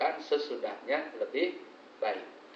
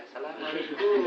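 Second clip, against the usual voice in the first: gentle greeting. Retold or imitated. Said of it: "Awalnya saya kayak Rasul saya".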